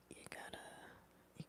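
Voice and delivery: whisper. Real, not acted. Sudden whispered aside.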